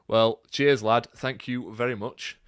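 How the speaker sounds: a light, attempted Yorkshire accent. Thanking a West Yorkshire viewer in a light Yorkshire accent. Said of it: "That is my attempt at a Yorkshire accent".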